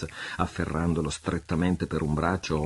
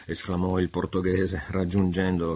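ordinary delivery

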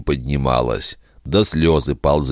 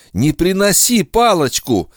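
high, strained plea; desperate cry; 3 dB louder than usual. Acted. Repeated, rising plea.

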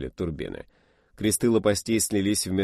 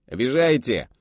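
boomed protest; 5 semitones higher, 6 dB louder than usual. Acted. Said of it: "прогудел тот".

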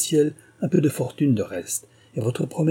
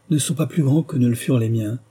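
ordinary delivery, nothing marked

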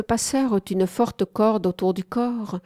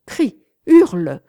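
loud, drawn-out taunting order; loud command; 4 semitones higher, 10 dB louder than usual. A narrator's reading. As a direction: urge him loudly to howl. Whipping mistress orders him to scream. (ordre lancé d'une voix forte)

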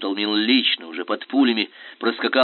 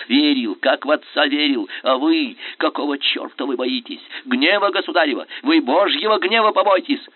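high, loud enraged outcry; furious shout; 5 semitones higher, 5 dB louder than usual. A narrator's reading. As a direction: hurl it at him in fury. Skobelev rages at prince. (бешеный выкрик)

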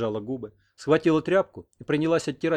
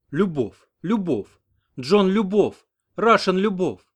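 broken Russian; 7 semitones higher, 7 dB louder, slower than usual. A narrator's reading. American speaks broken Russian.

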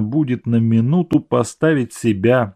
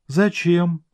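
surprised; 7 semitones higher than usual. A narrator's reading. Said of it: "спросила она с удивлением".

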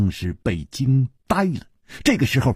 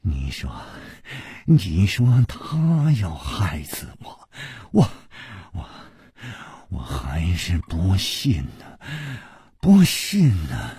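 murmuring; 5 semitones lower than usual. A narrator's reading. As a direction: murmur it to yourself, half whispered. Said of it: "喃喃地说".

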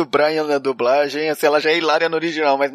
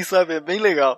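usual speaking voice

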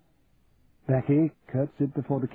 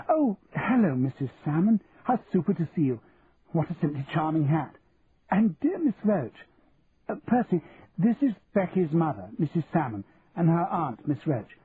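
higher, swooping society greeting; sociable Daphne, gushing; 5 semitones higher than usual. Acted. Daphne greeting Becky's mother and introducing Percy, gushing. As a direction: greet them effusively, then make introductions.

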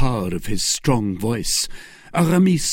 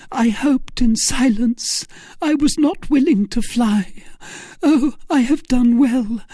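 high-pitched rapturous lady's voice; joyful young woman; 5 dB louder than usual. Acted. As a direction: cry out high to a long-awaited love.

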